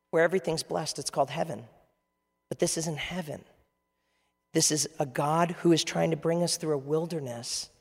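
normal talking voice

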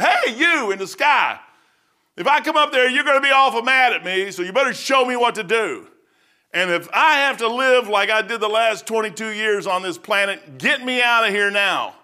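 high, loud cry to the sky; desperate plea. Retold or imitated. Lost on a back porch, crying out to God, loud and high. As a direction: beg heaven to show him what to do.

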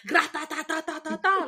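high, loud machine-gun rattle; mock gunfire. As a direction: rattle off rapid gunfire noises. Imitating gunfire while joking.

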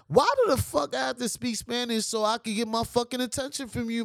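ordinary talk, nothing marked

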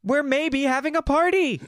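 high, loud and giddy; bursting with party joy; excited. Acted.

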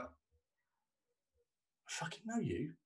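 a slow, soft, drawn-out mock threat; slow menace. Two men recognising each other, slow mock-menacing hush. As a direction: hush it out slowly like a playful threat.

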